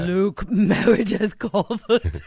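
slow, loud laughing announcement of the name. Real, not acted. Coworker laughing as she tries out the baby's full name.

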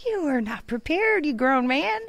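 high mock scolding; scolding sister. Sister scolding unready grown brother, high teasing rebuke. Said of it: "I was like".

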